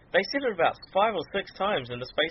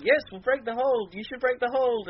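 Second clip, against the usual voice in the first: mock manager; 9 semitones higher than usual. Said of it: "Paul Heyman was like".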